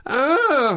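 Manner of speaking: high, loud mock tennis shriek; mock female tennis grunt. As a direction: tease him with a girly grunt.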